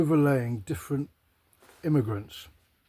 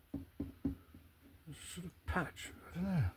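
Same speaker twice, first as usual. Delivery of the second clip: muttered aside; 5 semitones higher, 14 dB quieter, slower than usual. Real, not acted.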